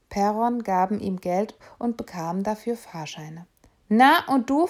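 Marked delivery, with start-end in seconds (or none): 3.9-4.7 louder, higher gruff conductor question; a ticket inspector turning to the boy; conductor asking. Acted. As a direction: put the boy on the spot. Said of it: "fragte er den Jungen".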